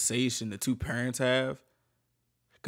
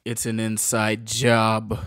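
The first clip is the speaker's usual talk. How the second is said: slow, low, dramatic detective verdict; deadpan verdict; 3 semitones lower, 5 dB louder, much slower than usual. Acted. Listener jokes to storyteller, slow dramatic detective voice. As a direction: announce it like a TV detective, slowly. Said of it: "joking detective verdict".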